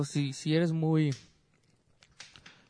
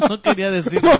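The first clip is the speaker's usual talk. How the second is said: real laughter breaking through, higher and louder; entre risas; 7 semitones higher, 9 dB louder than usual. Real, not acted. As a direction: say it while cracking up. Broma interna, se ríe. (muerto de risa, voz aguda)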